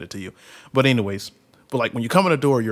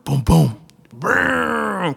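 higher, much louder boom sounds; imitated bike bumps; 10 semitones higher, 9 dB louder, much slower than usual. Telling how the uncle hopped the curb, booming. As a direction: bang out the bike hitting the curb. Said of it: "sound effect of bike".

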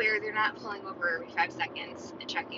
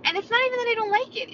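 much higher, louder and faster; emphatic protest; 8 dB louder, faster than usual. Retold or imitated. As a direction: insist hard that it's not about disliking it. Insisting to Nick about pizza.

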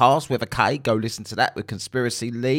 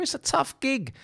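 sarcastic. Acted. Sarcastic aside on research.

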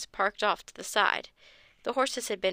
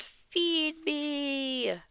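higher monster cry, put-on voice; zombie yell; 6 semitones higher than usual. A narrator's reading. Man from the grave grabbing ankle, zombie yell. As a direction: groan it like a hungry ghoul.

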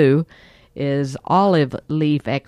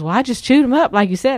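customer's reply; 9 semitones higher, 5 dB louder, faster than usual. Retold or imitated. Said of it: "he goes".

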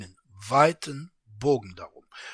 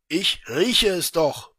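a louder, slow retort; shouting back; 5 semitones higher, 9 dB louder, slower than usual. Acted. Traveller shouts back at the official, louder and slower. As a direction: yell it back, drawn out. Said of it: "brüllte der Reisende zurück".

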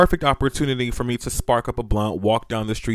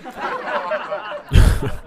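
high laugh. Real, not acted. Laughs at own story.

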